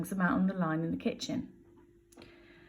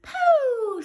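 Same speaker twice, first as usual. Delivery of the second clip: put-on baby voice; 7 dB louder than usual. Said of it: "says the baby".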